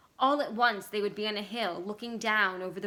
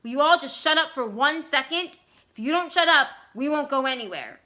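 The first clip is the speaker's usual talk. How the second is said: loud, fed-up yell; father stopping the car, yelling at the kids; angry father; 5 semitones higher, 8 dB louder than usual. Acted. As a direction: bark it over the kids' noise. Father yells at screaming kids.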